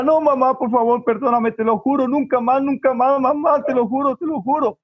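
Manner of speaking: put-on high-pitched, unbroken child's pleading; desperate begging. Boy clings to his mother at her feet, acted desperate begging. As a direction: beg frantically as a frightened boy. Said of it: "se le tira a los pies de la mamá".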